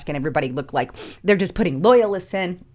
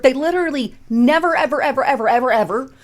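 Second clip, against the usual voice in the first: never and each ever punched higher and louder; stress on NEVER; 7 semitones higher, 5 dB louder, faster than usual. Real, not acted. Outraged at the officials, stresses never, ever, ever loudly. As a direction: hammer never, ever, ever, ever home. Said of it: "I gotta emphasize that word".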